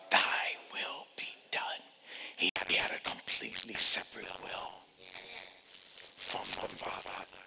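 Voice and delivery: soft whispered reverence. Real, not acted. Preacher quotes Jesus praying, whispered. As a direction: whisper the prayer.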